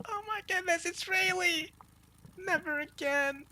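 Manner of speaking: very high mock female squeal; swooning woman. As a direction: gush like a rescued damsel, voice way up. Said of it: "She's like".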